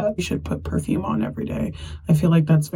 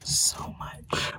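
soft whispered aside; 11 dB quieter than usual. Real, not acted. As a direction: say it under your breath.

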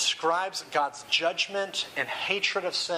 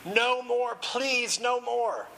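anguished cry; 8 semitones higher, much slower than usual. A narrator's reading. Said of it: "he yelled again as though his insides were on fire".

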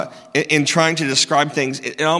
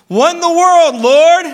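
much higher and louder, panicked cry; freaking out; 13 semitones higher, 9 dB louder than usual. Retold or imitated. Mary overwhelmed by the angel's news, crying out in panic. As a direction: cry out to God in sudden alarm. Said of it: "She was freaking out".

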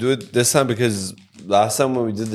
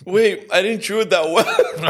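high, slow, laughing words; laughing through the burn; 8 semitones higher than usual. Real, not acted. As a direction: laugh it out in pain. Just ate spicy chip.

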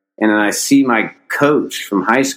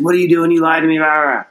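mock angry yell, pitched up; imitating dad's outburst; pissed dad; 7 semitones higher, faster than usual. Dad yelling at his lying son, mock angry. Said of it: "like, pissed".

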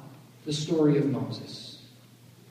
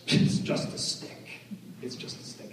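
hushed whisper; 3 semitones lower, 7 dB quieter than usual. Retold or imitated. Mock dismissive self-talk.

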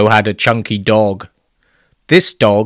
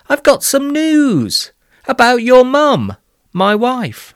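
high, eager dad announcing; enthused; 11 semitones higher than usual. Acted. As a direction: burst out with the happy news. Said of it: "His dad enthused".

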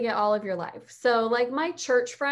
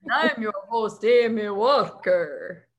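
the Count; 5 dB louder than usual. Pretend. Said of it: "like, the Count".

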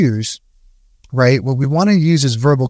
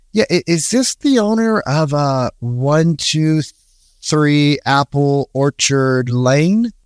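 slow, hesitant, curious opener; unhurried curious cold call; slower than usual. Shown as a demonstration. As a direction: ask slowly like you're just wondering. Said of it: "Very curious, very slow".

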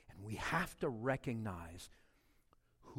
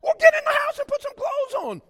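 much higher, loud scolding yell; husband's shout; 16 dB louder than usual. Retold or imitated. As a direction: order her back into the house. Husband yells at wife.

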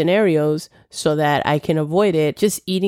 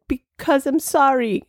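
higher, slow, pleading mock self; helpless apology; 10 semitones higher than usual. Her retold self explaining the apology, higher, slow and helpless. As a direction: stretch out a helpless sorry.

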